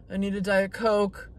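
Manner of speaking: ordinary delivery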